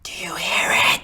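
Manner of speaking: loud, projected whisper; big forceful whisper, no voice; stage whisper. Acted. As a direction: whisper it so the back row hears. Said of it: "Stage whisper, buddy".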